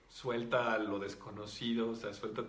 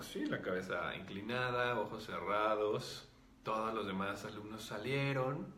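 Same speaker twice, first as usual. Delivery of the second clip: ordinary delivery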